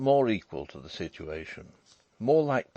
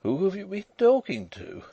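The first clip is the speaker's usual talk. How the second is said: high voice, as through a yawn; high, sleepy woman's voice; yawning wife; 11 semitones higher, 3 dB louder than usual. A narrator's reading. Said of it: "she yawned".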